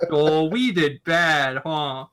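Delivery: slow, dopey comic-relief henchman; blundering henchman. Acted. Player acts out O'Malley's dumb henchman, slow and bumbling. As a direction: play a dim, bumbling sidekick. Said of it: "blundering comic relief henchmen".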